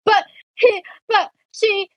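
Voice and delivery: high, broken-off mock sobbing words; imitated crying child. Upset little girl trying to use her words, mock tearful stammer. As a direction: imitate a child trying to talk through tears. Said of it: "she's crying".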